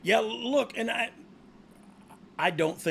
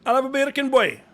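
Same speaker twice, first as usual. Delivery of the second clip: louder hammed-up Jamaican accent; mock Jamaican accent; 8 semitones higher, 7 dB louder than usual. Goofing on a podcast, he orders bacon in a mock Jamaican accent. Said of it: "saying bacon in Jamaican".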